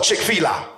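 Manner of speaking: "Chick-fil-A" said as "chick-fee-la"; mispronounced brand name. Retold or imitated. Cousin naming the new place, mispronouncing "Chick-fil-A" as "chick-fee-la". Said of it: "What you mean Chick-fil-A?".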